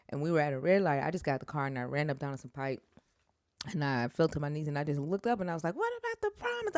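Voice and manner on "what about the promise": high, fast cry at the sky; high desperate plea; anguished cry. Retold or imitated. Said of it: "I'm, I'm mad".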